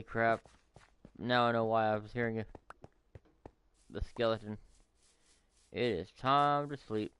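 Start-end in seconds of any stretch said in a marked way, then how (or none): 5.7-7.1 slow, drawn-out mock sleepy voice; sleepy drawl. Gamer heading to bed in the game, slow mock sleepy voice. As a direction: stretch it out like nodding off.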